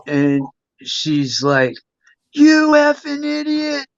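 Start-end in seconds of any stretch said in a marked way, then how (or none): 2.3-3.8 mock a frantic mother's telling-off, pitched way up; imitating mom yelling. Son imitating his mom yelling at him after the fall, scolding. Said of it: "she's just yelling at me".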